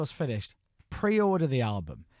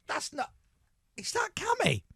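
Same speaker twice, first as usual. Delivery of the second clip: much higher, disbelieving cry; astonished; 3 dB quieter than usual. Retold or imitated. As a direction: sound amazed it is really him. Hearing the surprising track.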